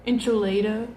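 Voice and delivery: enchilada butchered as "en-chuh-LAY-doh"; mispronounced. Retold or imitated. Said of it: "something ridiculous".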